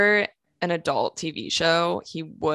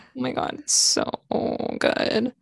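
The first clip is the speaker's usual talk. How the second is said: creaky, dragged-out gush; fry through the words; vocal fry; 6 semitones higher than usual. Pretend. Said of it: "The vocal fry".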